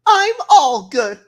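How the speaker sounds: loud high gasp, then drawn-out words; gasp, then stretch it high and loud; hammed-up theatrical gasp and cry; exaggerated gasp. Acting out Cortana's line.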